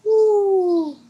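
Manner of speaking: loud high whoo to blow off steam; sharp whoo of release; calming exhale. Real, not acted. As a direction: let out a big whoo to calm yourself. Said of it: "I know, I need to chill".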